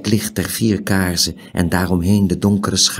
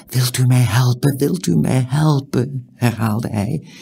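pleading in a higher pitch; urgent pleading; 6 semitones higher than usual. A narrator's reading. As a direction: beg insistently for help. Stranger begs Tiuri for help.

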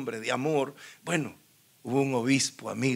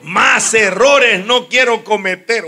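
emphatic resolve; 7 semitones higher, 16 dB louder, slower than usual. Retold or imitated. Quoting his own resolve, laughing.

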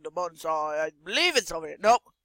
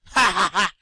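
evil laugh; 8 dB louder, faster than usual. Pretend. Laughing after reassurance.

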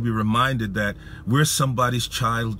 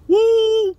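high, loud "woo"; overwhelmed whoop; 9 dB louder than usual. Retold or imitated. Preacher reliving the words that floored him, overwhelmed whoop. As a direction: let out a big overwhelmed whoop.

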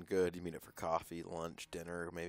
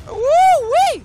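very high loud whoop; hype yell; 17 dB louder than usual. Real, not acted.

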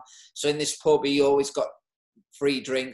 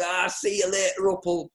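higher, louder jeering lads; mocking mates; 6 semitones higher, 3 dB louder than usual. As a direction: send him off with a teasing laugh in the voice. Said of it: "everyone started laughing, going".

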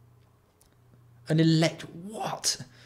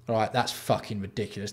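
normal talking voice

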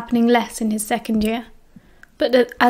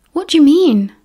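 higher and louder, suddenly alert; sharp, frowning demand; 5 semitones higher, 5 dB louder than usual. A narrator's reading. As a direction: challenge him with a frown. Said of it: "James frowned".

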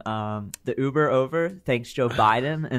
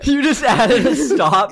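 high, laughing question; real laughing disbelief at the Uber story; 12 semitones higher, 8 dB louder, slower than usual. Real, not acted.

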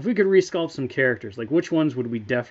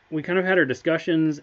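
usual speaking voice

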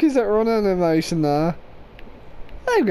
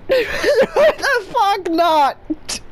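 laughing; 7 semitones higher, much slower than usual. Real, not acted. Laughing rant while gaming.

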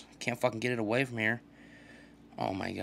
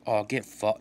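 normal talking voice

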